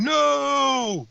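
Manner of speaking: high, drawn-out falsetto "no"; mock crowd yell. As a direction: imitate a heckler with a long high cry. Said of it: "people booing and yelling".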